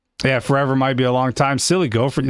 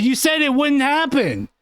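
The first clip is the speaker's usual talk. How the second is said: usual speaking voice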